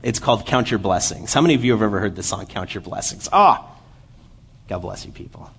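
ordinary talk, nothing marked

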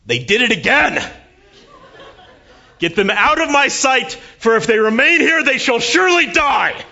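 very high, very loud, mock-grand booming rage; furious father yelling. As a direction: bellow like a wrathful father, hammed-up. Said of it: "yelling in his voice".

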